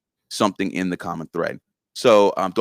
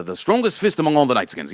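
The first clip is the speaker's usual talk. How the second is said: lightly voiced proud boaster, raised pitch; Yask; 6 semitones higher, 3 dB louder than usual. Guest quotes his character's boast, lightly proclaimed. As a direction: declare it like a character's proud title.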